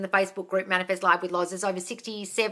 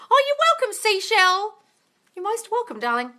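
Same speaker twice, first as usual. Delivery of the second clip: very high, gushing sing-song thanks; sweet thank-you; 13 semitones higher, 4 dB louder than usual. Real, not acted. Host thanking a fan in the chat, gushing high coo.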